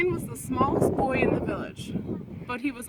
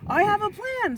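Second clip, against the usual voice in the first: eager; 8 semitones higher, 4 dB louder than usual. Acted. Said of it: "said Brian".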